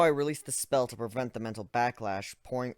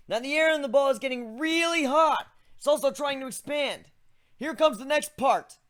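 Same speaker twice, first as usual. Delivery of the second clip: high character voice; 14 semitones higher, 3 dB louder than usual. Acted. Protagonist explains his spell.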